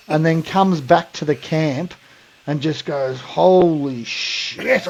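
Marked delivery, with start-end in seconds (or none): 3.2-4.9 very slow, drawn-out mock dismay; put-on suffering. Prankster returns to camp feigning illness, drawn-out mock dismay. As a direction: stretch it out like a feigned groan.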